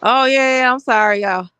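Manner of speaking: loud, giggly tipsy voice. Real, not acted. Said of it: "I'm about tipsy".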